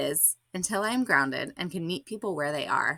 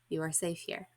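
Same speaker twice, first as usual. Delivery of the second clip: soft reassurance; 8 dB quieter than usual. Retold or imitated. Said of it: "She responds".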